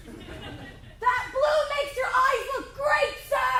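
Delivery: high, slow over-sweet compliment; encouraging shout. Acted. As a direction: cheer on the cop like a gushing fan. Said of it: "shout out encouraging things".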